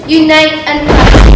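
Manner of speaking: loud call to arms; rally the heroes; rallying cry. Acted.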